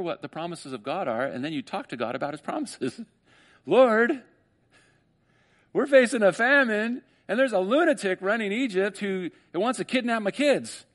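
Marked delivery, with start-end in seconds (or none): 3.7-10.8 higher, louder pleading cry to the Lord; desperate prayer. Acted. Jacob crying out to the Lord about famine, a higher, louder plea.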